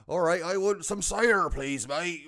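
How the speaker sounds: hammed-up West Country yokel, high and loud; West Country accent. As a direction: play a broad Somerset cider-lover. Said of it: "a couple of miles down the road is".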